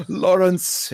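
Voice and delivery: gasp, then high, loud delighted cry; the raven overjoyed to see its master; acted joyful squawk of a name; excited bird greeting. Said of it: "hear him be like".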